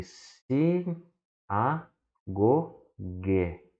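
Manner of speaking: slow separate syllables. Shown as a demonstration. Teacher showing syllable division, slow separate syllables. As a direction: break it into syllables for the learners. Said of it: "E temos aqui".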